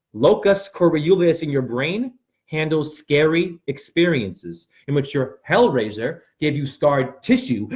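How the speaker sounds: ordinary talk, nothing marked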